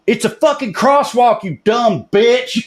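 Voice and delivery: retold yell. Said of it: "I yelled".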